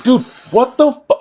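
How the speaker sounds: high, loud disbelieving shriek; shocked outburst. Retold or imitated. Student at teacher taking his exam, shocked high shriek. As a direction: squeal it in total disbelief, cut off. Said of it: "I'm like".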